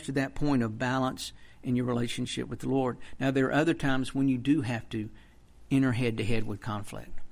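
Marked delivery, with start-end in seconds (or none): none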